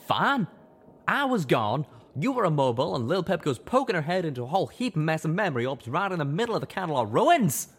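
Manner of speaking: high, loud, rapid furious rant; he lets it all out at her; roaring rant. Acted. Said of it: "Calamity roared".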